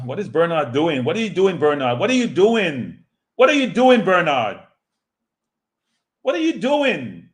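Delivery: rising frustrated yells at the player; exasperated shouting. Real, not acted. Yelling at player Bernard.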